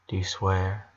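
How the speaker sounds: a hushed, timid question; small quiet plea. A narrator's reading. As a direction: ask it quietly like a worried little sister. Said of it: "hugged him with relief".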